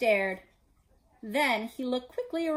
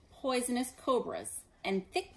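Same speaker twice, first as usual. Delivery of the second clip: usual speaking voice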